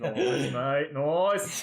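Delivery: rising, drawn-out Aussie approval; playful Aussie-accented praise; mock Australian accent. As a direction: cheer in a put-on Australian accent. Host mimics Aussie slang in reply.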